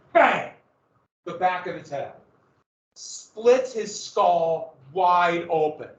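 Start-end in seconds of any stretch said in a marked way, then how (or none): 0.1-0.5 loud, abrupt bang of a blow; sudden impact. Acted. War club hits the back of his head, sudden bang. 3.0-5.9 very slow, savoring every word; drawn-out storytelling. Acted. Teacher telling class of the killing, drawn-out and dramatic.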